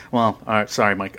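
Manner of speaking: ordinary talk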